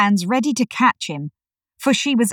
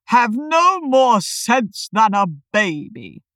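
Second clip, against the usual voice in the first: slow, higher pompous voice; Humpty Dumpty; 4 semitones higher, 4 dB louder, slower than usual. A narrator's reading. Humpty insults Alice while looking away, slow and haughty. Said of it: "said Humpty Dumpty".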